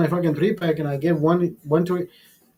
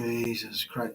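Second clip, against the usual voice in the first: much softer exasperated aside; muttered to self; 4 semitones lower, 12 dB quieter, slower than usual. Retold or imitated. Thinking to himself about the screaming kid, a soft weary sigh. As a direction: say it under your breath, worn out. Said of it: "And I'm thinking".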